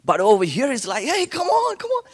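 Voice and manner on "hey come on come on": high-pitched inviting call; eager welcome. Retold or imitated. Church folk calling him over, eager welcome. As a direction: wave someone in with a high, eager voice.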